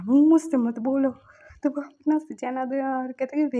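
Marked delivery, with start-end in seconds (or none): 0.0-1.1 louder, slow, cutting refusal; angry snap. Retold or imitated. Upset spouse rejecting the apology, loud slow refusal. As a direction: shut him down for good.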